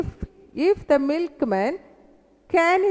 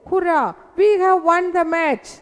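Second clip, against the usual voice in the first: raised-pitch victory cheer; high, triumphant exclamation; exclaiming; 6 semitones higher, 4 dB louder than usual. Acted. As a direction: announce a win like a cheering fan. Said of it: "these are the exclaiming words".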